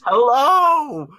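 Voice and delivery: loud, high call down a chimney; shouting down chimney. Acted. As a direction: yell it down into the hole. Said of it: "I'm shouting down the chimney".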